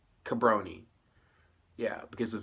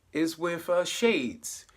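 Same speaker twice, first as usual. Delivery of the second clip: usual speaking voice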